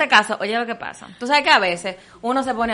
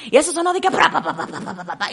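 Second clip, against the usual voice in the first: can clatter; 4 semitones higher than usual. Retold or imitated. Imitating cans falling.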